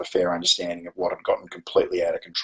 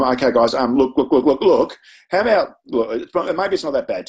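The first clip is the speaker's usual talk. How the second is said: higher, flustered stream of repeated words; panicked; 5 semitones higher, 4 dB louder than usual. Retold or imitated. Teacher about to lose thirty lunchtimes, acted panicky pleading. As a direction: scramble to backtrack, re-enacting panic. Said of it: "So I panicked".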